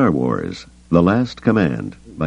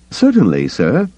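chirpy, higher eager droid; bright and eager; 9 semitones higher, 4 dB louder than usual. A narrator's reading. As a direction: answer brightly, eager to please. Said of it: "three P O said brightly".

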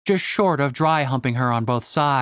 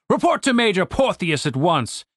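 indignant, pompous military officer; commander's ringing scolding; stern commander; 6 semitones higher than usual. Acted. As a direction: reprimand the men with grand outrage.

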